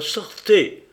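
loud, high-pitched indignant order; indignant cry. A narrator's reading. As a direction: throw him out with outrage. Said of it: "s'écria Claire dont l'indignation étouffait la voix".

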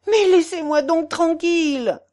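high-pitched, irritated protest; exasperated cry. A narrator's reading. Sick man begging the portress to stop, exasperated cry. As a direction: cry out to be left in peace. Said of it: "cria Pons".

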